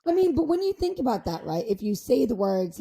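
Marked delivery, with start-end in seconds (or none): none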